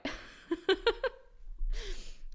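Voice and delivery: high breathy laugh; high laughter. Real, not acted. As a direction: burst out laughing. Laughing at herself.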